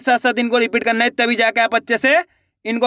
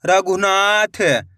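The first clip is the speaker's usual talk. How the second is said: slow, drawn-out blending of the word; slow clear reading; much slower than usual. Shown as a demonstration. Teacher to pupils, blending the word slowly. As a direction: stretch each syllable for the pupils. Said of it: "एक एक अक्षर का उच्चारण सही से करना".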